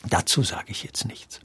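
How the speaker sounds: whispered, teasing refusal to answer; coy whisper. Real, not acted. Asked about actors, he dodges with a whispered quip. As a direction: hush it like a cheeky secret. Declines to judge fellow actors.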